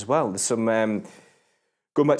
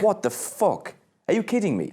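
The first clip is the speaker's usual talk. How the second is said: high, stunned outburst of disbelief; can't believe what he just heard; shocked disbelief; 9 semitones higher than usual. Real, not acted. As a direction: pitch jumps up in real shock. Shocked by Arctic Monkeys news.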